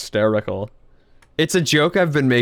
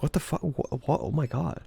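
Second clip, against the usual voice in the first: hushed stunned Grinch; 10 dB quieter than usual. Retold or imitated. Grinch reacting to the song.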